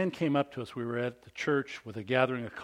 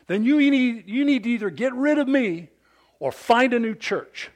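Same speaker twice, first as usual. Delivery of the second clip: higher and louder, a sustained tirade; mad dad; 8 semitones higher, 8 dB louder than usual. Retold or imitated. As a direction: drive him back with every line. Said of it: "He was flippin' mad".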